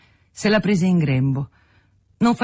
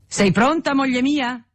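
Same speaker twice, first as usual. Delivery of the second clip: calling out; 9 semitones higher than usual. A narrator's reading. Husband calls to his wife from the doorway, calling out. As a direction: call out brightly pitched to someone across the room. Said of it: "gridò il signor Arnoux".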